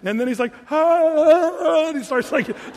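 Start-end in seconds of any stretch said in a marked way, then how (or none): 0.7-1.9 loud high panicked yelp; panicked yell. Retold or imitated. Retelling the Jordanian guy's scream, a loud high panicked yelp. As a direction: yelp like you're about to be launched. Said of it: "totally freaking out".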